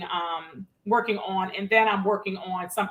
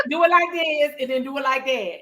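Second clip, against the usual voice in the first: teasing accent imitation, starting high; playful mock accent, raised at first; [name]; 3 semitones higher than usual. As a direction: mimic a friend's accent for laughs. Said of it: "is that my accent".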